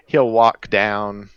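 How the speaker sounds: normal talking voice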